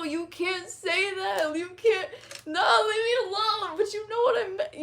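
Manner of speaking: high, whiny fake sob; hammed-up wail, begging to be left alone; mock crying. Mock wailing after gaffe.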